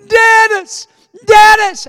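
very high, long-held holler; re-enacted full-voice scream; yelling. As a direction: yell the name so it carries to the house. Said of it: "heard his little brother yelling".

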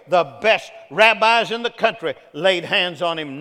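driving, rhythmic sermon repetition; full-force preaching. Acted. Preacher listing thirty years of waiting to the congregation, full-force preaching. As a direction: pound out each repeated phrase.

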